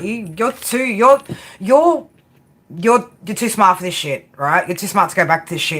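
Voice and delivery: ordinary delivery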